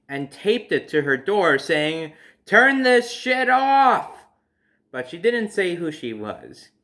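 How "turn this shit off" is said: high, slow, shouted demand; mock furious shout, dragged out; angry yell. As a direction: yell it out like the furious note on the door. Mom's angry note read aloud.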